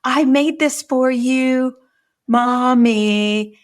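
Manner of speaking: put-on high, loud, slow child offering a gift; excited little girl. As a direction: sing it out like a proud kid. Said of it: "And she says".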